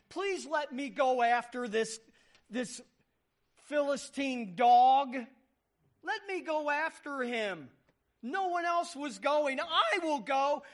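put-on a shepherd boy pleading with the king to let him fight; pleading argument. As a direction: beg to be sent to fight. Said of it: "and he's arguing".

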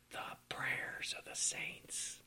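hushed reverent whisper. Acted. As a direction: breathe it out in awe. Preacher hushes on holy phrase.

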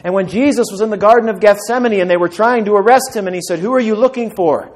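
loud, high, driving sermon voice; a pastor building to a climax; full-force preaching. Acted. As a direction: preach it out with rising fire.

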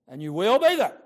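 emphatic warning. Real, not acted. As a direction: hammer home be in And you will be there. Preacher warns of the judgment.

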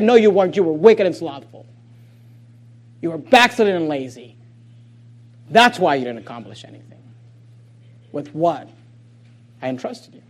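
the Lord calmly condemns; stern judge. Retold or imitated. Voicing Jesus's judgment.

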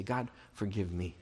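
soft, low plea to God; humble prayer. Acted. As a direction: confess your need humbly, starting very quiet. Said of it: "I come humbly to him".

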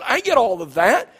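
ordinary talk, nothing marked

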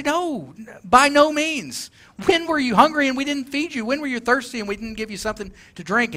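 mock shocked goats, pitched high; shocked protest. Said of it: "They're surprised".

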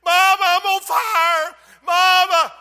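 high, loud terrified scream; a girl's panicked cry for mama; screaming child. Retold or imitated. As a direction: scream for your mother in terror. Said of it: "the little girl screaming".

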